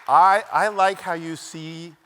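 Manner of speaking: put-on slow, emphatic Hungarian-accented praise. Hungarian mentor accepting student on the spot, slow accented praise. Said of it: "thick accent".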